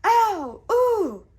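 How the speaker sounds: very high loud ow and ooh; pained yelps. A narrator's reading. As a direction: yelp as you fall. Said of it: "yelled the guards".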